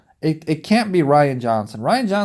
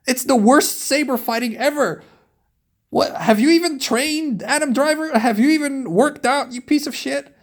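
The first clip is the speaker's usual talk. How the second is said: angry rant; 6 semitones higher than usual. Real, not acted. Vlogger ranting at Adam Driver, furious and high. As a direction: tear into the fight scenes, raised high.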